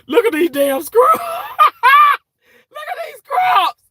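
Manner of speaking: laughing hard, high and loud; words squeezed out through laughter, very slow; can barely talk for laughing. Real, not acted. Laughing about his oversized scrubs.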